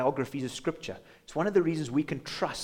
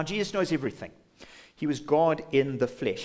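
ordinary delivery

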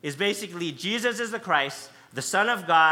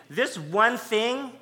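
slow, drawn-out stress on one; drawn out This one thing; emphasis on one thing; 5 semitones higher, much slower than usual. Shown as a demonstration. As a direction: lean on one and slow right down. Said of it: "Mark is making an emphasis".

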